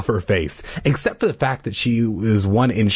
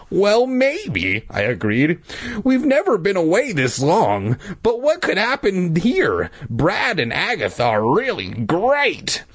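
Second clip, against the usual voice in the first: put-on high boy voice; 9 semitones higher than usual. Boy character answers sister.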